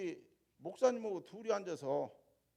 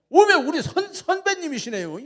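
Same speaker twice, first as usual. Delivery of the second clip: much higher, loud Jeolla cry of surprise; surprised Jeolla exclaim; 12 semitones higher, 19 dB louder than usual. Retold or imitated. Landowner discovering a fellow alumnus, high surprised Jeolla cry. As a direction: burst out in Jeolla dialect, delighted. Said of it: "전라도 말에 솔찬히 잘합니다".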